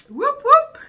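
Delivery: high, loud whoop whoop; cheer. Real, not acted. Cheering for the upload, high and loud. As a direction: celebrate like a hype call.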